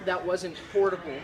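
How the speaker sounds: normal talking voice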